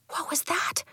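high, hushed question; startled whisper. A narrator's reading. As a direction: whisper it on alert. Child startled by a hoot.

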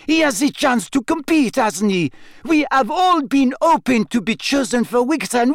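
put-on high, indignant French girl; French accent. A narrator's reading.

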